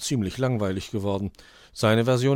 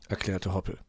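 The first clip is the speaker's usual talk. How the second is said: ordinary delivery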